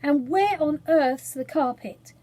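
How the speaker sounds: calling out to the cook in the sea; lightly shouted question; mild shout. A narrator's reading.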